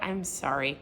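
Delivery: soft, low, sincere apology; demoing a soft sorry for her listeners. Shown as a demonstration. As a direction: say it gently, meaning it.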